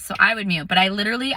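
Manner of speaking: normal talking voice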